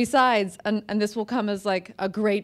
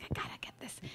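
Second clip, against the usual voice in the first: breathy excited whisper; whisper to herself; 19 dB quieter, faster than usual. Retold or imitated. Author recalling snapping a secret photo, whispered. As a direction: hush it like sneaking a photo. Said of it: "And I was like".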